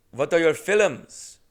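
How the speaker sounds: put-on slow, loud Indian-accented question; Indian accent impression. Clerk asking about his films, slow Indian accent. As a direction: lean into the Indian accent, drawn out. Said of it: "unfortunately, keep doing it".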